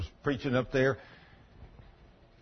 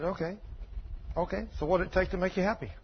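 soft conciliating; 4 semitones higher, 8 dB quieter than usual. Retold or imitated. Preacher answers softly, conciliatory.

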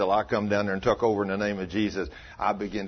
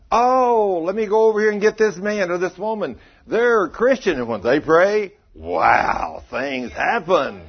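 excited coworker; 8 semitones higher, 7 dB louder, slower than usual. Retold or imitated.

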